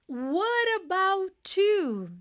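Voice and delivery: "What about you" drawn out very slowly, "what about" linked as "wota bawt"; very slow model question. Shown as a demonstration. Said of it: "And you?".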